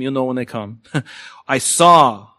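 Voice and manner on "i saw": "saw" hit loud and drawn out; emphasis on verb. Shown as a demonstration. Preacher marking verbs to underline, stress on "saw". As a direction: punch the word "saw". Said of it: "I'll emphasize them".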